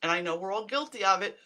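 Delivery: ordinary delivery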